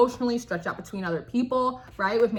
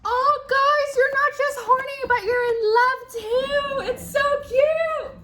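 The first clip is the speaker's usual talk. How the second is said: much higher, louder gushing over the cards; gushing squeal; 4 dB louder than usual. Real, not acted. Gushing over the soulmate card.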